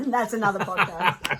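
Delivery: laughing loudly through the words; spoken through a burst of laughter. Real, not acted. Joking aside about not being married.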